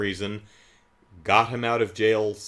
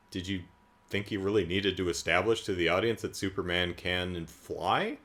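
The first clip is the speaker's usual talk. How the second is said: ordinary talk, nothing marked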